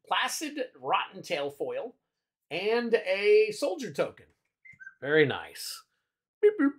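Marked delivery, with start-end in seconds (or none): 6.4-6.7 high playful phone beeps; beep boop. Pretend. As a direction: chirp it like an electronic beep. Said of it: "Got my phone going off on me".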